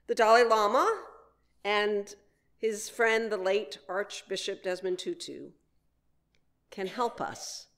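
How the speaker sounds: ordinary delivery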